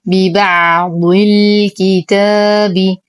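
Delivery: ببعض الكتاب with the ع held and a long ا in الكتاب; slow tajweed recitation. Shown as a demonstration. Teacher recites the phrase for students, slow measured chant. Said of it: "أصبر على العين".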